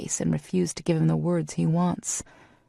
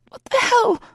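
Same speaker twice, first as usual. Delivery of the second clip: much higher, louder screech; 6 dB louder than usual. A narrator's reading. Heroine screeching when he pulls away. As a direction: shriek it in outrage. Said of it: "I screech".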